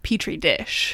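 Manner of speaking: "Petrie" as PEE-tree, slowly; like the dish. Shown as a demonstration. Host correcting co-host on Scully's version, "Petrie" as slow PEE-tree. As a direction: spell out "Petrie dish" slowly with a long first e. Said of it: "she's saying".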